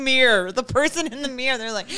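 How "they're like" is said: normal talking voice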